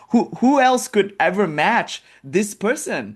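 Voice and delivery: high, drawn-out awe; excited mother. Retold or imitated. Mother awed by Vishnu, slow, high and excited. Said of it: "Parvati's mother is so excited".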